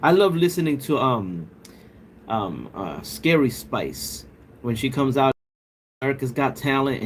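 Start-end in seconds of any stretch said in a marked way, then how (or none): none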